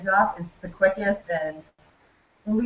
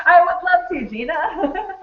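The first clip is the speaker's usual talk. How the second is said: high and louder through real laughter; laughing; 11 semitones higher, 7 dB louder, slower than usual. Real, not acted. Guest laughing as she answers the host Gina, laughing reply. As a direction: answer while still laughing.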